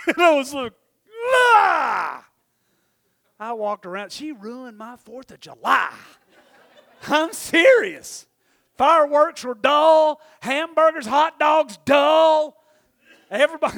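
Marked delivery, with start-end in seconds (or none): 1.3-2.3 loud shocked cry, higher and louder; mock-shocked loud ah; startled shout. Acted shocked reaction. 7.1-13.8 laughing complaint. Real, not acted. Comic complaint, laughing and loud.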